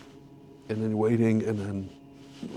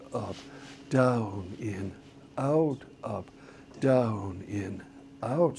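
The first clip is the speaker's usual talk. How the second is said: softer, steady called beat pattern; conducting call; 2 semitones lower, 6 dB quieter, slower than usual. Shown as a demonstration. Teacher calling the conducting directions in rhythm. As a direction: call the pattern in strict time. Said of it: "quick review of the four beat pattern".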